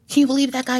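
high-pitched imitation of an amused boyfriend; mock incredulous glee. Boyfriend to her after peeling out, mock gleeful disbelief. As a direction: retell his gleeful disbelief, pitched well up. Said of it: "was laughing hysterically".

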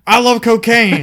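hammed-up TV pitchman yelling; Billy Mays pitchman. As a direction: yell it like an infomercial host. Billy Mays impression, loud.

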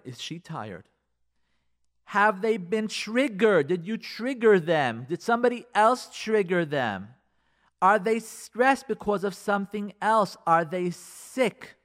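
ordinary talk, nothing marked

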